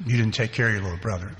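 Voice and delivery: hushed, self-accusing murmur; quiet guilty inner voice. Retold or imitated. Inner voice accusing him quietly of failing his brother. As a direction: let the guilt whisper low.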